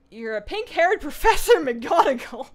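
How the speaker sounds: reads the chat line through real laughter; laughing while reading. Real, not acted. Reading a flattering chat comment.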